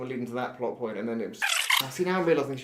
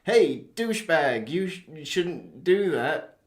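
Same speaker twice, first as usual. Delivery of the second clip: slow, swaggering mock hero warning; tough hero threat; 5 semitones higher, 5 dB louder than usual. Hero threatening the robber, slow mock-tough voice. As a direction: square up to the robber, drawling the threat. Heroic voice from next to him.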